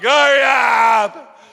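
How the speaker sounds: a husband in pain screaming at his wife to hurry. Retold or imitated. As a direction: yell at her to hurry. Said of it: "I'm screaming".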